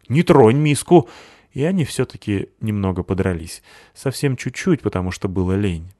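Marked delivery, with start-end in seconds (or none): 0.1-1.1 higher and louder, protective; guard the bowl fiercely; alarmed shout. A narrator's reading. Cat defends his bowl.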